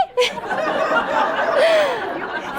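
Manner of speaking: a high, incredulous laugh; real laugh. Real, not acted. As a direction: laugh at the absurdity. Disbelieving laugh.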